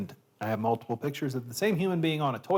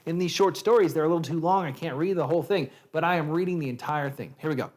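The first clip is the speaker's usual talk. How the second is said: normal talking voice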